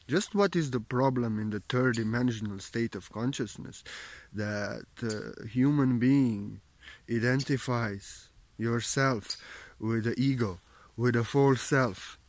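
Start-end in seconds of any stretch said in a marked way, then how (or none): none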